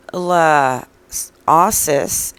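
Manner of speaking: La Osis loud, broken apart with a gap; exaggerated careful pronunciation. Shown as a demonstration. Host proving to viewer she got it, pointed pronunciation. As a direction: sound out La Osis piece by piece, punched hard. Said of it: "I think I got it".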